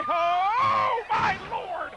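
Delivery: loud, high exclamation of shock; shouting in amazement. Real, not acted. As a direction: yell it like you can't believe it. Huge move lands, commentator erupts.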